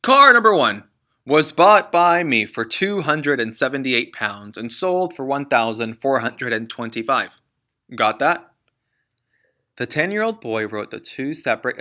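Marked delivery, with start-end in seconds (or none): 0.0-8.5 booming, impatient reading of numbers; loud dictating father. Acted. Father reading car profits to his son. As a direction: rattle off the sums at him.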